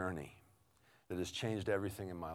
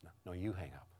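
dating sweethearts; 5 dB quieter than usual. Acted. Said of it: "it sounds like this".

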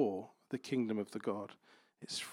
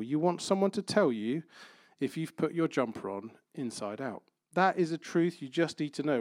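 ordinary talk

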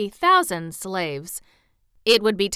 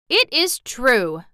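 loud, slow, higher declaration; proud declaration; 4 semitones higher, 7 dB louder, slower than usual. A narrator's reading. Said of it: "declared the general, proudly".

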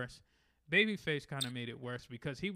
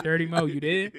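calling out louder into a bad connection; louder, rising call; 3 semitones higher, 10 dB louder than usual. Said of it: "He was like".

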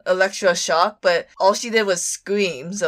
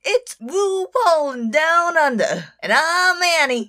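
put-on a failed, high-pitched stab at an Australian accent; mock Australian accent, badly done; 10 semitones higher than usual. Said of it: "I can't do the accent".

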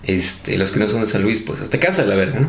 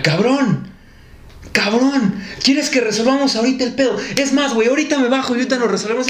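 raised, higher-pitched confrontation; imitated angry challenge; 10 semitones higher than usual. Angry driver turning round on him to fight, imitated aggression. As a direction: pick a fight, words rushing toward the end.

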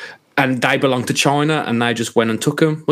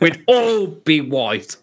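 slow, drawn-out deadpan; 3 semitones higher, much slower than usual. Acted. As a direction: draw each word out deadpan. Deadpan joke repeated slowly.

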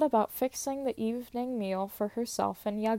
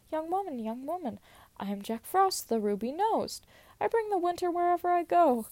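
a hint of a high sprite; lightly voiced Jack Frost; 6 semitones higher than usual. Jack Frost presenting himself to the girl, lightly voiced. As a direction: announce yourself like a boastful frost spirit. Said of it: "he said".

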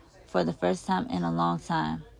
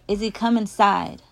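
loud, urgent plea; worried mother's raised voice; frantic mom; 3 semitones higher, 7 dB louder than usual. A narrator's reading. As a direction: call out urgently, pleading. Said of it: "Mom is frantic".

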